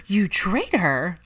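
slow, drawn-out cry of betrayal; hammed-up accusation, dragged out slowly; mock outrage. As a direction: play the wounded traitor line for laughs. Pansy accuses Ginny, mock betrayal.

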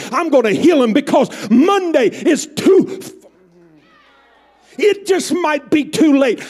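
loud, high, building sermon climax; preaching at full force. Acted. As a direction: preach it with fire.

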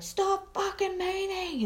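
high-pitched, drawn-out mock scream; retold scream. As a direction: scream it slowly at your own hand, without full volume. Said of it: "I'm screaming at my hand".

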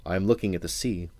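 plain reading voice

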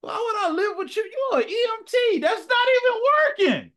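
high, loud, scornful taunt; high mocking shout. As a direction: yell it up high like a bratty teen. Voicing the girl mocking the nurse.

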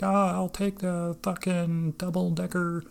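high, slow customer voice; customer ordering. Retold or imitated. Acting customer ordering at the counter.

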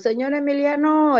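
called out high at the door; calling out. Retold or imitated. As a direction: call out to him from outside.

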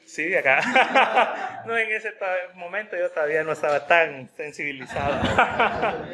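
chuckling through the words; real embarrassed laughter; laughing. Real, not acted. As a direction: laugh at your own past self.

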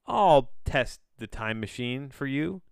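volunteer it like an eager hero; offering Hawkeye. Retold or imitated. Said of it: "Hawkeye's just like".

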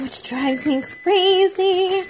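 high, drawn-out exasperation; exasperated. Real, not acted. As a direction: stretch it out in high-pitched frustration. Venting about her health problem.

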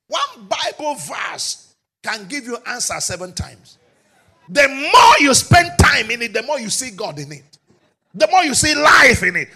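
loud, ringing sermon delivery; rising pulpit shout; full-force preaching. Acted. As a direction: drive it home to the congregation. Preaching climax.

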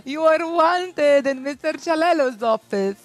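high, slow, formal school announcer; PA announcement. Retold or imitated. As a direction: page a student over the speakers. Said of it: "announcement no P A system".

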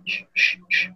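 sharp unvoiced shush. Real, not acted. Shushing the noisy friends on the call, unvoiced. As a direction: hush the others with a long shh.

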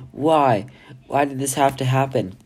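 plain reading voice

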